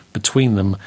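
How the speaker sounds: usual speaking voice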